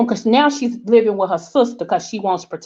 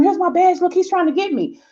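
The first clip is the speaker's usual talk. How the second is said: frightened plea; 9 semitones higher than usual. Retold or imitated. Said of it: "So she's telling them".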